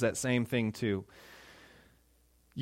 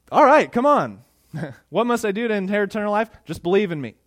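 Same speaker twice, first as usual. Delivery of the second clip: breezy salesman Jesus; 5 semitones higher, 5 dB louder, faster than usual. Retold or imitated. Mock easygoing Jesus.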